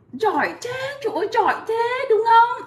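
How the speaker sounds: put-on high, drawn-out praise for a small child; gushing praise. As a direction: coo with delight at the pupil. Teacher praising the child learner.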